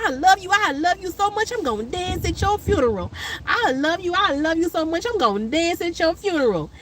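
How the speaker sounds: high sing-song doting voice; doting old mama. Retold or imitated. Mama fussing over her kids, high doting coo. Imitating friend's mama repeatedly.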